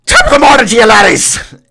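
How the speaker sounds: very high, very loud mock-Irish screech; ear-splitting Irish-accent impression attempt; Jacksepticeye Irish greeting. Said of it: "in a strong, high-pitched Irish accent".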